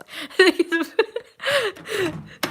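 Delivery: high-pitched amused laughing. Real, not acted. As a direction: laugh helplessly at your own attempt, pitched high. Laughs at own failed accent.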